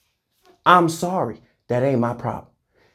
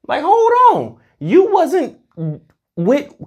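squeaky outburst; 11 semitones higher, slower than usual. Real, not acted. Ranting at leechers.

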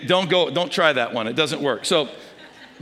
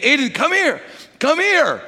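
calling out; 6 semitones higher than usual. Retold or imitated. Grandfather calling toddler over.

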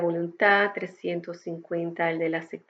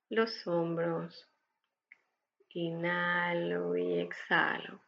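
calm breathing guide, unhurried and gentle; guided meditation; 5 dB quieter than usual. Acted. (voz de meditación guiada)

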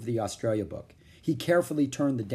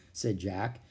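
ordinary delivery

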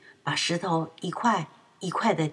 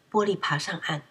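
usual speaking voice

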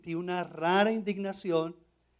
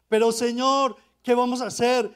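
higher and louder, pleading in a rush; anxious pleading; 6 semitones higher, 9 dB louder than usual. Retold or imitated. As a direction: beg the Lord, fretting over the lost house.

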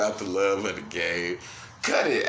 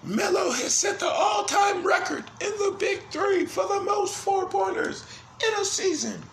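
high, shouted sportscaster call; over-the-top broadcast shout; mock announcer shout. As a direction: hype it like a play-by-play announcer. Mock announcer hyping Melo record.

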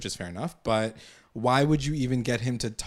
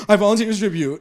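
higher, much louder proclamation; mock heroic shout; 9 semitones higher, 9 dB louder, faster than usual. Archie stands up in court, mock heroic shout. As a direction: call it out like a hammed-up volunteer.

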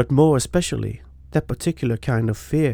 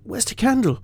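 higher, stammering and urgent; frightened; 5 semitones higher than usual. A narrator's reading. Tom, terrified, telling his friend about the man in his room. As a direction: clutch at the memory in panic.

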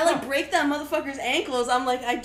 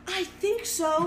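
higher, softer, unsure answer; tentative mock reply; 4 semitones higher, 5 dB quieter, much slower than usual. Mom answers police asking if it's her kid, tentative. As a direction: voice a mom hedging, quietly and a little high. Mom's doubtful reply to officers.

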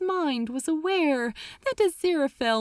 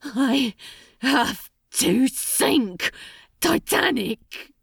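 strained repeat; 3 semitones higher, slower than usual. Acted. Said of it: "he repeated".

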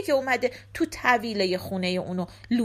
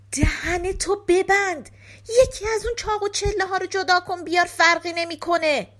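raised, high-pitched scolding; exasperated scolding; 6 semitones higher, 4 dB louder than usual. Acted. Thieves order Giske to shut up, exasperated. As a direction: shut up a noisy accomplice impatiently.

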